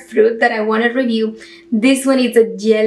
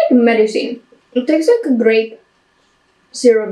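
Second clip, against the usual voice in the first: talking between bites; chewing while talking. Real, not acted. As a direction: mouth partly full of fruit. Seen 1: Fruit in hand at mouth, jaw working while talking until 3.9.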